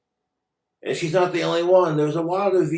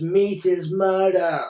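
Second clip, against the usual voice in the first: slow, higher sneering mimicry of a vegan slogan; mocking slogan; 5 semitones higher, 4 dB louder than usual. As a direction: drag it out like a smug protester.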